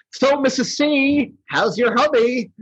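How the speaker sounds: high, breezy cartoon villain; Snow Miser. Snow Miser talking to Missus Claus, high chummy voice. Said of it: "when Snow Miser's talking to Missus Claus".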